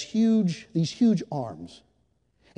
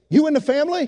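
booming question; 10 semitones higher, 7 dB louder than usual. Retold or imitated.

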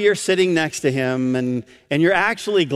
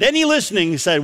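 usual speaking voice

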